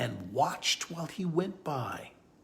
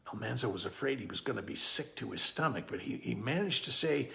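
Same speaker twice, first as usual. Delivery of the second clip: usual speaking voice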